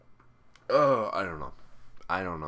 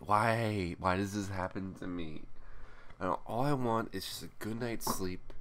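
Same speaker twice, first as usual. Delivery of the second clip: ordinary talk, nothing marked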